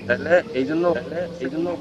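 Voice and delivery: each phrase repeated by mic echo. Real, not acted. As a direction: speak through a feedback echo. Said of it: "sir, যে প্রতিধ্বনি হচ্ছে".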